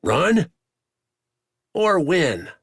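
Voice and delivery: loud, high eager challenge; shouting. A narrator's reading. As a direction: call it out mid-brawl. Wax calls out mid-fight.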